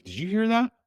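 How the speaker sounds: a sudden high, startled "did you hear that"; shaken, urgent question to a buddy. Retold or imitated. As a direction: blurt it out in disbelief. Asking buddy about the voice.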